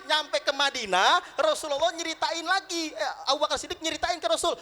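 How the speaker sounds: normal talking voice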